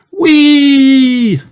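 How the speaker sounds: high, loud, held-out whee; gleeful whee. Acted. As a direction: squeal with joy on the slide. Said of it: "laughs Peppa".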